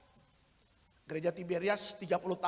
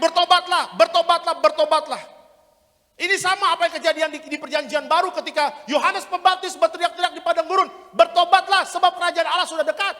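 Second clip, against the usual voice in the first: loud, high, shouted preaching; full-force revival preaching; fiery preaching; 10 semitones higher, 17 dB louder than usual. Acted. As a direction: thunder repentance at the congregation.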